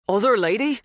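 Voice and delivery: put-on high, rising, unaccented echo; startled question. A narrator's reading. Dan seizing on the farmhand's words, startled. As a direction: echo it back as an urgent question.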